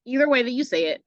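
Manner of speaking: ordinary talk, nothing marked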